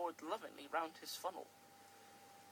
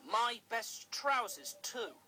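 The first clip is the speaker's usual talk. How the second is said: slow cross complaint; 4 semitones higher, 7 dB louder, slower than usual. Acted. Controller fumes.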